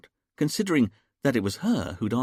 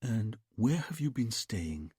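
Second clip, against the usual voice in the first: softer, slow soothing trance voice; hypnotic murmur; 4 dB quieter, slower than usual. A narrator's reading. Hypnotist questions Julia softly.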